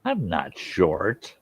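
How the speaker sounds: slow mock protest. Real, not acted. Mock-offended reply.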